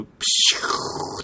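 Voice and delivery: long drawn-out escaping-steam hiss; imitated smoke hiss. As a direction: mouth a slow hiss of smoke. Imitating a console self-destructing in smoke.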